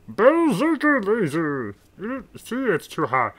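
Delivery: high, slow, loud alien voice; strained high villain voice; Marvin the Martian. Acted. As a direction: draw it out like a cartoon weapon cry. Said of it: "I can't get my throat to pull back far enough".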